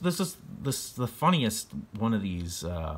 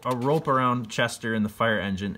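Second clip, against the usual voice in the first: usual speaking voice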